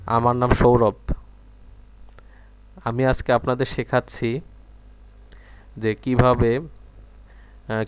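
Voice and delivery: phrase by phrase with long pauses; careful dictation. Shown as a demonstration. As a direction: dictate each phrase clearly to the mic. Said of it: "লিখি দেখি তো বাংলা আসে কিনা". (থেমে থেমে স্পষ্ট করে ডিক্টেশন)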